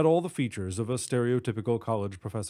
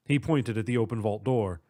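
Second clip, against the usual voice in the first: plain reading voice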